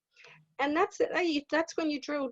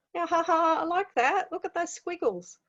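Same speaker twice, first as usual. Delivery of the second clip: put-on a light, higher, giggly little-child voice; delighted child; 7 semitones higher than usual. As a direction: lightly play a child laughing at squiggles. Small child delighted by squiggles.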